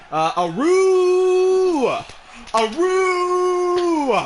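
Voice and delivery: long, high, drawn-out tiger howl. Pretend. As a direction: howl it out like a big cat. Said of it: "I will give out the signature, uh, Tiger howl".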